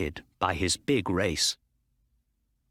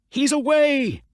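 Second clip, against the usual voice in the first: high, loud cry of joy; excited cry; 7 dB louder than usual. A narrator's reading. Owner crying out as his horse pulls ahead, high and loud. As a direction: shout it as he breaks clear. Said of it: "cried Mister Ross".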